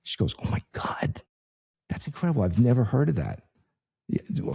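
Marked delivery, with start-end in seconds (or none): 0.4-1.2 breathless whispered awe; a hushed, amazed receptionist; awed whisper. Retold or imitated. 1.9-3.4 amazed. Retold or imitated. Receptionist amazed.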